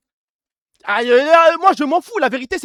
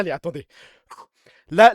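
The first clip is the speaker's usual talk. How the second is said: ordinary talk, nothing marked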